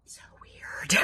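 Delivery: unvoiced little whisper. Real, not acted. Vlogger whispers to herself about her odd eye. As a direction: breathe it out to yourself.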